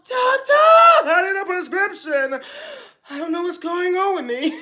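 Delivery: hammed-up panicky caller, Doctor yelled; mock patient. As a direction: play the frantic patient for laughs. Mocking patient calling doctor.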